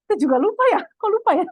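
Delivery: giggling through the words, pitch up; laughing. Real, not acted. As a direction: laugh while admitting you forgot. Laughing at forgetting the placing. (tertawa geli)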